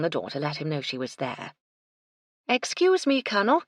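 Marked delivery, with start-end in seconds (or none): 2.5-3.6 raised, higher voice to get attention; clear, lifted hail; loud. A narrator's reading. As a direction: call out politely but loudly. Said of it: "she said loudly".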